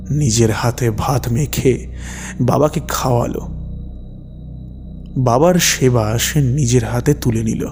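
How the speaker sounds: plain reading voice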